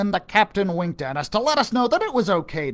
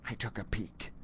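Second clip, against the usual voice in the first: hushed whisper; 7 semitones lower, 15 dB quieter than usual. A narrator's reading. Narrator whispers while hiding from the snake. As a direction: whisper as if peeking out.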